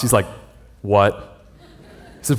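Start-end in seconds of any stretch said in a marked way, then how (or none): 0.8-1.1 voice drops. Retold or imitated. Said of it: "And his voice just drops".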